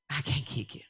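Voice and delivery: usual speaking voice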